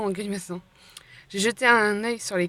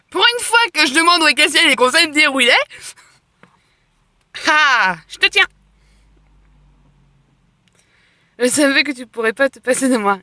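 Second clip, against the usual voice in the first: much higher, louder triumph; triumphant; 6 semitones higher, 10 dB louder than usual. Real, not acted. Finally finding Castiel, triumphant and loud. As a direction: crow over finally catching him.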